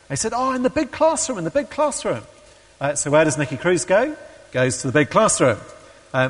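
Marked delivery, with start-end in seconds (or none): 0.3-2.2 high-pitched, breezily elated reply; excited retold answer. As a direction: imitate an overjoyed man pointing the way. Excited convert answers the seeker.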